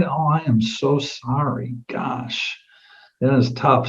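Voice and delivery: soft, hushed sympathy, the gosh nearly breathed; sympathetic condolence. Acted. Agent to grieving client over her sisters, soft sympathetic hush. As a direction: sink into quiet condolence.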